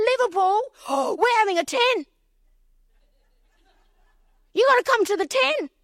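high, gasping excitement; gasp, then gush the invite high; acted-out delighted surprise; thrilled invitation.

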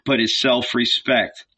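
ordinary delivery, nothing marked

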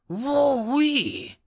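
high, excited whoop. A narrator's reading. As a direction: let out a wild whoop. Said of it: "Mills whoops".

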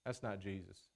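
dropped low and soft, flatly dismissing; quiet low rejection of a wrong claim; low dismissive aside. Retold or imitated. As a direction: mutter it under your breath like a private verdict. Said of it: "and I'm like".

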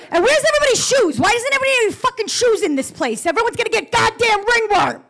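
high, fast, shrill-pitched Italian mom rant; a high, fast mock rant from a fussing mother; mother's rant. Mother ranting, voiced in story.

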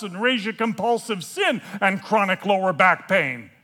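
high, very loud heckling holler; yelling out. Acted. Stranger at the back of the room topping the confession, yelling out. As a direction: shout a challenge across a big room. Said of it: "someone in the back of the room yells out".

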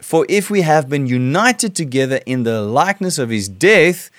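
scripture reading. Acted. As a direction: read the verses with weight, like a sermon. Preacher reads scripture aloud.

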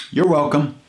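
high, bright little thank-you; lightly voiced elderly lady; chirpy old lady. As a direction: toss it off cheerfully as she drives away. Ladies reply before leaving.

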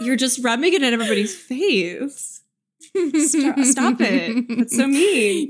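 high, whiny mock complaint; mock whiny scolding. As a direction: pretend to be hurt and scold. Mock scolding over the joke.

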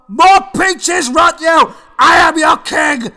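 loud, high royal tantrum; roaring tyrant king. Acted. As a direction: bellow it like a spoilt king. Tyrant king demanding peaches.

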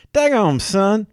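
hammed-up Southern exclamation; Southern accent. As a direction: ham up a Southern good ol' boy. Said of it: "Southern and Cajun type, uh, accents".

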